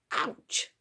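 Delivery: yelp. Acted. Bitten from behind.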